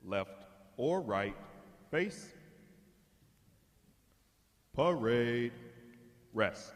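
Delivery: loud drill commands. Acted. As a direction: call the commands across the parade deck. Calls drill commands again.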